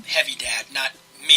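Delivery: normal talking voice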